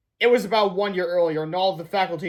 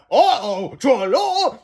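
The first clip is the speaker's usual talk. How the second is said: cartoon exclamation; 5 semitones higher, 6 dB louder, much slower than usual. Comic exclamations voiced.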